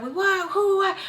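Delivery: high, rapid-fire mock interrogation; imitated outburst. Mom hearing about a fight, imitated startled outburst. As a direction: cut in with high, startled questions.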